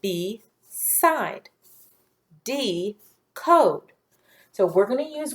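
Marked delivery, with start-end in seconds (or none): 0.0-3.9 "B, side. D, code" each syllable on its own; long-vowel syllables spoken one by one; split syllables. Shown as a demonstration. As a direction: pause between "B" and "side", "D" and "code". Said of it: "This B is long because it is open".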